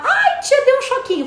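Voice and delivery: put-on very high, loud little boy squeal; child's voice. Therapist retells the boy feeling the needle, high squealing child. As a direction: squeal like a startled small child. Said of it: "aí eu começo a estimular".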